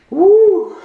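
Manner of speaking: high, loud whoop after a burning sip; whiskey whoop. Real, not acted. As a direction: whoop as the liquor hits.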